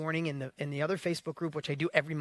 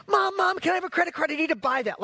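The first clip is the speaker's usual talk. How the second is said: put-on much higher and louder, an eager child pleading; ten-year-old self begging mom for a credit card; begging kid; 9 semitones higher, 5 dB louder than usual. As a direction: whine to mom like a kid who must have it now.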